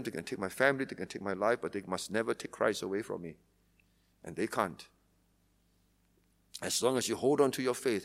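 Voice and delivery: quiet, intimate preacher close; hushed earnest preaching. Acted. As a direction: bring them close and speak it low. Intimate closing of sermon.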